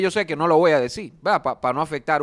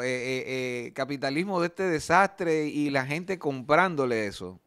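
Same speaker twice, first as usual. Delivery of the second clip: ordinary delivery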